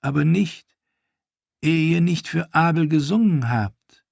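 cruel mock pity. Ash taunting Theon with false pity, high cooing. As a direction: coo false sympathy at him.

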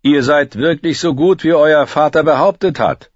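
king praising the victor, carrying loud. A narrator's reading. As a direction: project to the whole tournament ground. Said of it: "Renly war auf dem ganzen Feld zu verstehen".

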